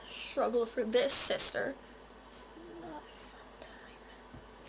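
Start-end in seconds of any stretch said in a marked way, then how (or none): 2.3-4.7 breathy whispered aside, slowed right down. Real, not acted. A fourteen-year-old vlogger whispers her allergy gripe to her viewers. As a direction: drop to a whisper, as if confiding.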